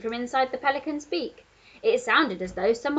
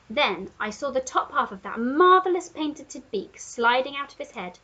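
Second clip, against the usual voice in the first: usual speaking voice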